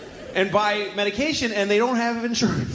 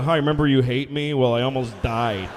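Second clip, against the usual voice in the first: low, subdued mock phone call; understated, deadpan call to the ex; sheepish ex-husband; 8 semitones lower than usual. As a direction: offer it awkwardly, sinking lower. Imagined phone call to ex-wife.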